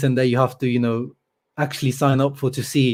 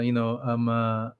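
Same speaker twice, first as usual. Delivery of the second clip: ordinary delivery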